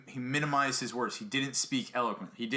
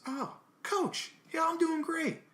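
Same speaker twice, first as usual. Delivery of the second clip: eager player; 14 semitones higher, slower than usual. Retold or imitated. Player greets his coach brightly.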